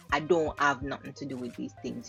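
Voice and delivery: ordinary delivery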